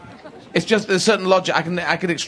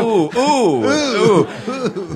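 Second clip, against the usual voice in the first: high, playful "ooh" calls; imitated exuberant oohs; 6 semitones higher, much slower than usual. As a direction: mimic pilgrims trying out an excited ooh. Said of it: "they will go".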